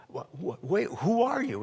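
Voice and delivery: stammered, rising bewildered question; stumble, then pitch the question up high; baffled Isaac. Retold or imitated. Father meeting a second Esau, confused.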